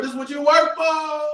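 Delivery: loud preaching shout. Real, not acted. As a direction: drive the point home loudly. Preaching about money.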